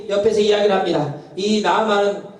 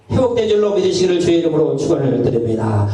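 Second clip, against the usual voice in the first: louder, pressed preacher's blessing; preaching blessing; 5 dB louder than usual. Real, not acted. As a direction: proclaim it over the congregation with force. (힘찬 축원 설교 톤)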